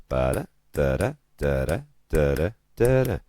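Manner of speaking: slow, loud da-DUM pulse on "Ba-da-da-da"; iambic rhythm. Shown as a demonstration. Teacher beating out the meter, "Ba-da-da-da" in slow weak-strong pairs. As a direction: beat out "Ba-da-da-da", every second syllable stressed. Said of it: "that's where we get it from".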